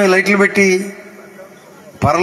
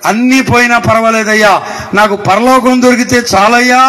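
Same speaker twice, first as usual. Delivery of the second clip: raised, ringing cry of faith; impassioned declaration; 4 semitones higher, 4 dB louder than usual. Acted. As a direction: proclaim it as an acted heartfelt vow. Acted cry of devoted faith.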